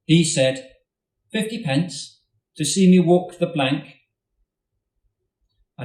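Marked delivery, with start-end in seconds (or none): 1.3-4.0 a hint of Tomek's accent, higher and louder; lightly voiced Tomek. A narrator's reading. Tomek daring the big boy, higher and louder with a hint of accent. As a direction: call out the bet, higher and bold.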